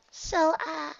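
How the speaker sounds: very high, shy filly voice; a shy little filly. A narrator's reading. Filly nervous.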